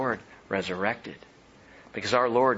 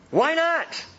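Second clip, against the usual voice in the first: rhetorical question. Real, not acted.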